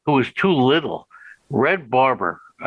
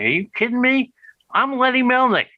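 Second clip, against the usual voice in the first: excited; 9 semitones higher than usual. Retold or imitated. Thrilled to land his guest.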